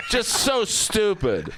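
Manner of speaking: ordinary talk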